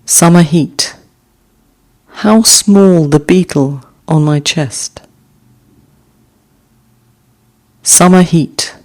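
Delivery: slow, measured haiku reading with pauses; gentle recital of a short poem. Acted. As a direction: let each image settle before the next. Reads haiku aloud twice.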